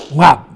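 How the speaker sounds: sudden loud "whap"; short, percussive hit; impact noise. A narrator's reading. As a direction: smack the word out like a blow. Narrator imitates the rock's impact.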